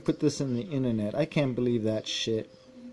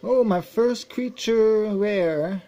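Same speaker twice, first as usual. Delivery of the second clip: excited; 6 semitones higher, 4 dB louder, slower than usual. Real, not acted. Excited at rare card pull.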